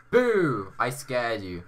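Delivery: sudden playful "boo" shout; playful scare. Pretend. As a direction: shout it to make them jump. Playfully scaring a viewer.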